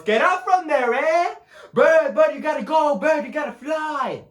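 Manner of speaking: put-on high, loud, urgent child shooing a bird; high-pitched pleading yell; excited little son. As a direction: shout like a worried kid at a pigeon. Voices young son urging pigeon.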